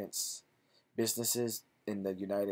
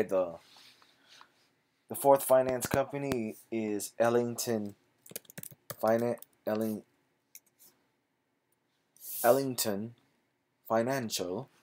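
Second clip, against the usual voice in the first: put-on British accent; 3 dB louder than usual. Vlogger trying out a British accent on a company name. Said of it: "Gotta work on my British, British accent".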